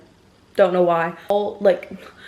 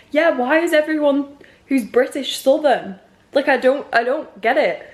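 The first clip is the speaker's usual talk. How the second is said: higher, emphatic mock exasperation; baffled complaint; 6 semitones higher than usual. Chatting to a fellow northerner about southerners, exasperated. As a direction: protest in playful bafflement, pitch swooping up.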